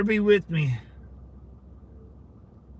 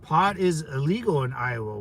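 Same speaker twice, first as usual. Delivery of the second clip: ordinary talk, nothing marked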